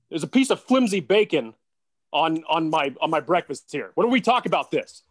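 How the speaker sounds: high, loud, put-on whiny complaint; whiny bacon complaint. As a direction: complain like a spoiled coach at breakfast. Complains to wife about bacon.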